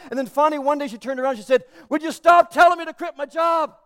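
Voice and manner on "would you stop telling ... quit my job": loud, high-pitched exasperated outburst; exasperated shout. Retold or imitated. Wife turning on her husband, exasperated shout. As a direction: cry out, fed up with being told.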